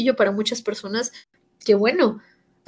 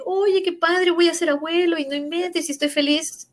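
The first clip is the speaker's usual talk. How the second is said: father's happy excitement; 6 semitones higher than usual. Retold or imitated. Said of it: "y me dice".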